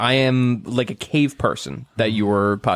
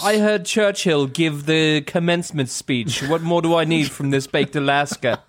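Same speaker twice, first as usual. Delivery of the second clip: a touch of posh, all over the globe; muddled British-ish accent; 5 semitones higher than usual. Acted. Host riffing on the baking show in a pompous mixed accent. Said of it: "I have no idea what accent I'm doing right now".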